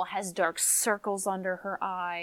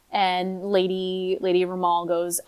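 usual speaking voice

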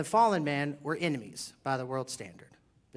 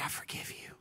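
whispered mock confession; 12 dB quieter than usual. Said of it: "every wife just needs to turn to her husband and say".

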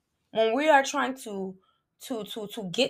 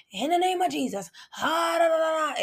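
higher, hammed-up pretend prayer in tongues; mock tongues prayer; 6 semitones higher, faster than usual. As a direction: mimic showy babbling prayer. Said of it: "it doesn't have to be".

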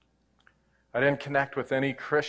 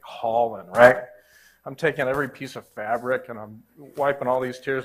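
ordinary delivery

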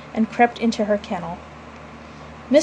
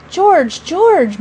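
plain reading voice

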